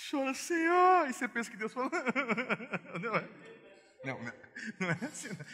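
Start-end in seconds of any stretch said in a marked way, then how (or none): 0.1-1.1 high, loud, slow wail to God; mock pleading prayer. Believer begging God to cry with them, hammed-up wail. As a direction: ham up a tearful plea. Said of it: "você vai".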